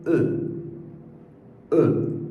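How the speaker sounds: French mute e sound. Shown as a demonstration. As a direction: say E the French way, rounded uh. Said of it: "em francês se pronuncia".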